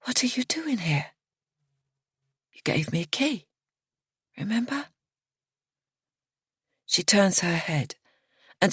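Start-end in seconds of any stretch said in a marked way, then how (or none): 0.0-4.9 soft higher whisper; startled whisper; hushed question. A narrator's reading. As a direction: ask it breathless and hushed. Narrator voicing startled self.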